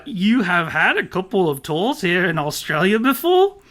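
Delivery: hammed-up Aussie question, rising at the end; Dinny. Podcaster resumes interviewer's question, bad Australian accent. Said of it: "my bad Australian accent".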